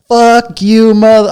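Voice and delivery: high, loud mock yell at the crowd; imitated comic yelling. Comic yelling back at a booing crowd, loud mock yell. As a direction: belt it like a furious comic on stage. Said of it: "He said".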